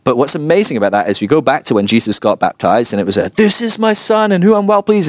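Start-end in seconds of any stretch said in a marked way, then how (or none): 3.3-5.1 a lifted, booming voice of God. Retold or imitated. Preacher voicing God speaking from heaven, lifted and booming. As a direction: proclaim it like a voice from heaven. God's voice at the baptism.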